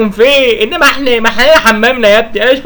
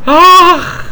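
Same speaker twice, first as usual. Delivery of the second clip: sudden higher cry of pain; 7 semitones higher than usual. Acted. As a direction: yelp it out as if struck. Father cries out in pain. (صرخة ألم حادة)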